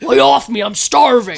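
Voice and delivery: loud sketch-comedy outburst; Gap Girls. Acted. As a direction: snap back in a hammed-up character voice. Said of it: "Doing the Gap Girls".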